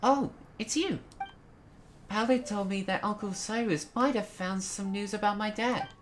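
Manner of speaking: put-on high, light little boy voice; child character Joel. Voices young Joel's game dialogue.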